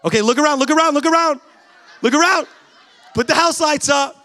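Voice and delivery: high, very loud call to the crowd; loud, high-pitched hollering; urgent playful shout. Real, not acted. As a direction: shout it out like an excited matchmaker. Jokingly urging singles to look around.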